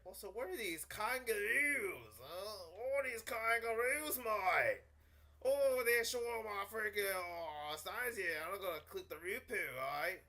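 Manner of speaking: hammed-up Aussie outburst, pitched high; broad, loud mock-Australian; Australian accent. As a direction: exclaim like an over-the-top Australian. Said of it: "I gotta stop with the accent".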